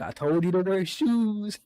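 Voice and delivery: a hint of a scolding dad; dad's told-you-so. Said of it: "Papito's like".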